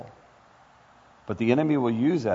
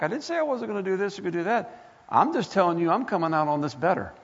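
high, impassioned preaching voice; rising sermon intensity; fervent preaching; 8 semitones higher than usual. Acted. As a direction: preach it up with fire. Preaching declaration of faith.

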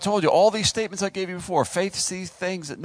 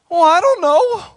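loud, very high mock protest; hammed-up high-pitched doubt; doubtful squeal; 14 semitones higher, 10 dB louder than usual. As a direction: whine it up high as a reluctant hero. Acting out Gideon's doubtful reply.

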